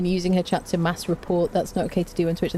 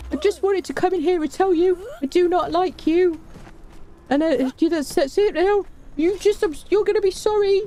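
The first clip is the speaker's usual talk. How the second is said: mock troll threat; 10 semitones higher than usual. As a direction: play the sulky troll hamming it up.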